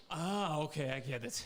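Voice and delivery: usual speaking voice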